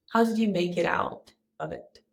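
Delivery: ordinary delivery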